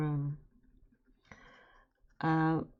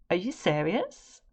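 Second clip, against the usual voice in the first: disbelief; 5 semitones higher, 7 dB louder than usual. Retold or imitated. Offered the job by phone.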